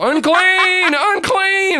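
high, loud leper's cry, drawn out; yelled warning. Acted. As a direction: cry it out like an outcast warning everyone. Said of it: "They have to yell".

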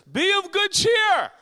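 high, drawn-out full-voiced yell; shouting Jesus. Jesus calls to the boat over the wind, shouting. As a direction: holler it over a blowing wind. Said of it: "I'd yell back".

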